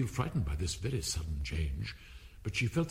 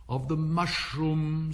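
slow, higher, drawn-out answer; lofty caterpillar; 5 semitones higher, 3 dB louder, much slower than usual. A narrator's reading. Caterpillar answering Alice's thought, slow and lofty. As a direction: stretch the words out loftily.

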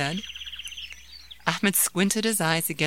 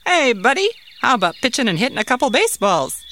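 a bright, high, fast uncle voice; cheerful uncle; 7 semitones higher, 7 dB louder, faster than usual. Acted. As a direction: call out to the boy eagerly, pitched up. Uncle invites nephew to play.

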